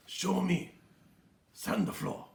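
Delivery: soft, low sensei voice; quiet Miyagi; calm Miyagi. Retold or imitated. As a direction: instruct quietly like a master. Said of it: "just like Miyagi said".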